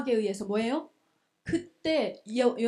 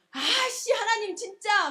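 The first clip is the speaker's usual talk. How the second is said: high-pitched mock whine of annoyance; comic burst of irritation; exasperated Jonah; 8 semitones higher, slower than usual. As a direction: grumble up at God, fed up. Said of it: "막 짜증을 내니까".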